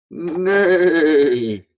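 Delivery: high, loud neigh; whinny like a horse. Shown as a demonstration. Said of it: "horse makes the sound".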